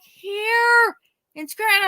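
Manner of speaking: high, slow, drawn out; ominous emphasis. Retold or imitated. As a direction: stretch it for drama. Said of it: "He's like".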